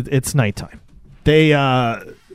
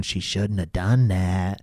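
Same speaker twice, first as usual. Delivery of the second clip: low, slow, softer ominous voice; voice in her head; 4 semitones lower, 5 dB quieter, slower than usual. Retold or imitated. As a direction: draw it out low, like an eerie inner voice. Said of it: "you hear this voice going".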